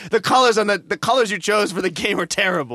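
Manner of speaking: mock-rant at the game's makers. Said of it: "he's just screaming and waving his arms".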